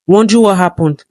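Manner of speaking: plain reading voice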